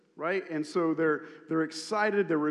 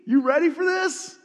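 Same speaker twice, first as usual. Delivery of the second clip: much higher and louder, eager; excited announcement; 8 semitones higher, 7 dB louder, slower than usual. Retold or imitated. Jesus revealing himself to the crowd, excited announcement. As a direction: build up to a big reveal. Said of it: "Jesus stands up and says".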